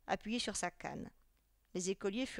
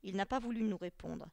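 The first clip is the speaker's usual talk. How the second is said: ordinary delivery, nothing marked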